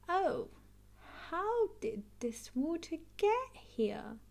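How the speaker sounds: lightly voiced puzzled dad; a hint of a bemused father; Daddy Pig wondering where the water came from, mildly puzzled. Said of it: "Daddy Pig asks".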